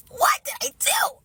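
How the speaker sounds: high-pitched cry of disbelief; hammed-up wailing question; exasperated outburst. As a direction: burst out suddenly, way up high. Bursts out after hushed questions.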